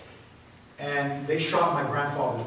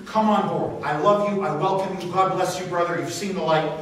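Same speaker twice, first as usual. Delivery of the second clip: louder, beaming come on board; enthusiastic welcome; 3 semitones higher, 5 dB louder than usual. Acted. Host greeting a former Obama supporter, loud and eager. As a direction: throw your arms open to the convert.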